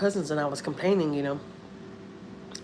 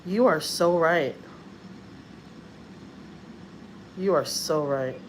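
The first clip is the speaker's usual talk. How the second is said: quiet sincere agreement; 6 dB quieter, much slower than usual. Retold or imitated. Stunned agreement with dying lady.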